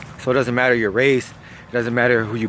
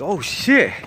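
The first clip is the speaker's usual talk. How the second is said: higher-pitched retold shock; shocked reaction; 6 semitones higher than usual. Narrator hears of second hand chopped, shocked. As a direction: replay a stunned exclamation.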